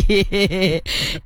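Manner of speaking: amused chuckle; light giggle at a joke. Real, not acted. Chuckles after reading listener's joke. (tawa kecil geli)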